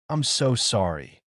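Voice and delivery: ordinary delivery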